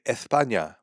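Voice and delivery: "España" with a TH in place of the S; joke lisp. Shown as a demonstration. Explaining the joke from the video, lisped pronunciation. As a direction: lisp "España" for comic effect. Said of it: "thought it would be funnier to say España".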